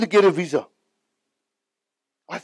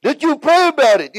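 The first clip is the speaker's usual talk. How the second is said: eager question; 10 semitones higher, 6 dB louder than usual. Retold or imitated. Asking Reinhard every year.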